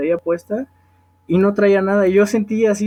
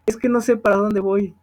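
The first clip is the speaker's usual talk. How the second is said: usual speaking voice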